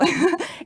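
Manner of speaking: a bright high burst of laughter; real laugh. Real, not acted. Telling listeners about her inner voice, a real laugh. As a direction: laugh at yourself.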